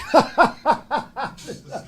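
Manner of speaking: a high burst of laughter; high amused laugh. Real, not acted. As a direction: laugh at your own punchline. Laughs at own punchline.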